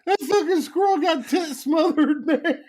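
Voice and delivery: hollering the line through laughter; can't hold it together, half yelling; high laughing outburst; laughing shout. Real, not acted. Cracking up at the story.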